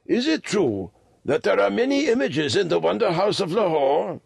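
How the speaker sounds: put-on high-pitched, earnest old lama; old lama, enquiring. A narrator's reading. Old lama checking on the museum's images, high voice. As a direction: ask high, like an old pilgrim.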